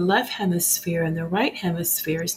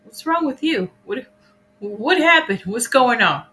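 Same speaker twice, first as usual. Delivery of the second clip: demanding questions; 5 semitones higher, 5 dB louder than usual. Retold or imitated.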